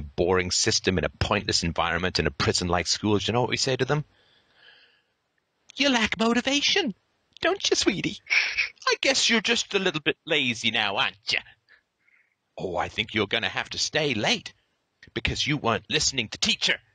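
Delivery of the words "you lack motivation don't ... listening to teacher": high, sugary condescending teacher voice; patronising sweetie. Pretend. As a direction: talk down like to a toddler.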